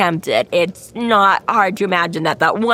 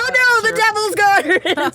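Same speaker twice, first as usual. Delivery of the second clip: higher, slow hammed-up cry; mock alarm; 12 semitones higher, slower than usual. Villager reacting to the seizure, hammed-up mock alarm. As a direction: play a villager in fake panic.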